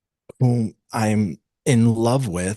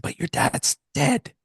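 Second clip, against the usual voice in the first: high, slow incredulous objection; retold disbelief; 9 semitones higher, slower than usual. Man reminding his partner her dad is dead, incredulous. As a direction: pitch it up and spell out the problem.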